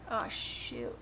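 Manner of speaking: ordinary talk, nothing marked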